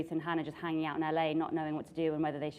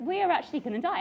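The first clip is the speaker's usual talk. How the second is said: mock panic; 9 semitones higher, 5 dB louder, faster than usual. Retold panic on broken ride.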